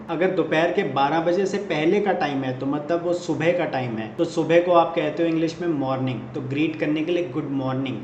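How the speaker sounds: ordinary delivery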